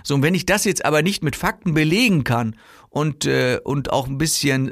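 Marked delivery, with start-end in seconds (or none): none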